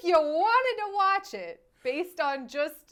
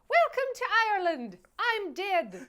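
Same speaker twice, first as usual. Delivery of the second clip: mock Irish accent in a higher Walken voice; Christopher Walken Irish accent; 7 semitones higher than usual. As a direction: imitate a bad Irish accent, high and amused. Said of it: "from an Irish Christopher Walken that says".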